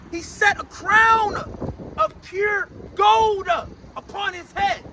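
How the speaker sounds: slow, punched phrases with stretched endings; drawn-out pulpit proclamation; forceful psalm preaching. Acted. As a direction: hammer each phrase, pausing between. Preacher repeats verse for effect.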